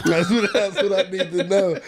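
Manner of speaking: high, laughing retort. Real, not acted. Host teasing a friend about his facial hair, laughing. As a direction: squeeze the words out through real laughter. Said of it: "I can't stop laughing".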